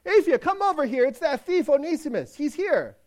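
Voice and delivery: high, loud call over the shoulder; loud high call to the wife in the house; yelling. Acted. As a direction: holler to his wife inside. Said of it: "he yells behind his shoulder".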